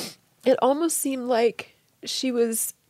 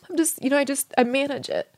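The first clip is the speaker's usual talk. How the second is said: ordinary talk, nothing marked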